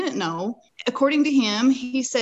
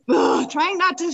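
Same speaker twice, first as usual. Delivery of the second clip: high, exasperated groan into words; held-back panic; 9 semitones higher, 4 dB louder than usual. Retold or imitated. Rider reliving the fright for a listener, held-back panic. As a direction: re-enact fighting down panic.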